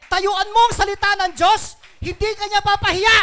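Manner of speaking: high, very loud preaching cry; shouted exhortation. Real, not acted. Preacher urging congregation to stand on God's word, shouted exhortation. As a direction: drive it home at full voice.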